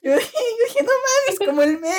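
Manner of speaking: very high, laughing squeal; barely getting words out through laughter; cracking up. Real, not acted. Friend laughing at own earthquake story, helpless giggly squeal. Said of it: "Nadie se está riendo nada más".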